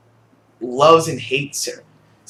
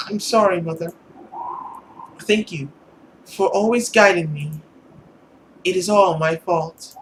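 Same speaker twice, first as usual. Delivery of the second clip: put-on higher, small girlish plea; pleading daughter; 3 semitones higher than usual. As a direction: beg mother gently to stay. Voices the girl apologizing.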